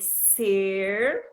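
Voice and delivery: esquecer read very slowly, syllable by syllable; leitura silabada. Shown as a demonstration. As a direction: stretch ESQUECER out as if reading with a child. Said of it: "lê com ele, né, a palavra". (leitura pausada de "esquecer", sílaba por sílaba)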